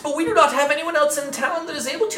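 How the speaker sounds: high, fretful town priest; worried priest pleading for help; high cleric voice. Acted.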